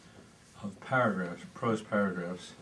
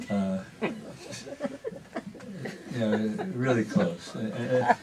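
laughing; 4 semitones higher, slower than usual. Real, not acted. Laughs at own confession.

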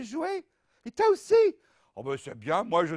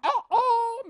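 mock surprised; 7 dB louder than usual. Box surprise again.

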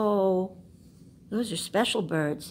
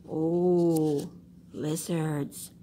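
ordinary delivery, nothing marked

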